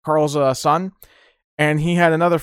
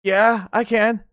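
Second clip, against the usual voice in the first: high, slow, drawn-out answer; mock innocent, slow and high; son's reply; 7 semitones higher, much slower than usual. Son answering father, slow and high. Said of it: "He goes".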